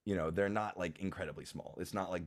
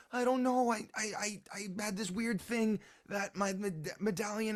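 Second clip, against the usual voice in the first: put-on high-pitched little boy, stammering; Leuven. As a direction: explain yourself like an excited small child. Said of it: "And Leuven says".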